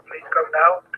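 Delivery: usual speaking voice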